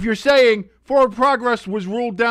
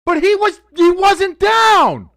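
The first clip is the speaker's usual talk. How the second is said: excited shout, higher and louder; real shouting; 9 semitones higher, 5 dB louder than usual. Real, not acted. Fan yelling at the screen that the runner wasn't down. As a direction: yell it like the fumble is yours.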